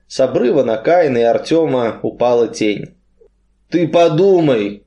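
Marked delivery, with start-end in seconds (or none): none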